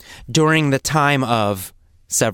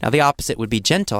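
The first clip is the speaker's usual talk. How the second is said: normal talking voice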